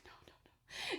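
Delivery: unvoiced, frantic whisper; whispered panic. Watching the screw move again, whispered panic. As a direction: whisper it in dread.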